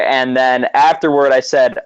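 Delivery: ordinary delivery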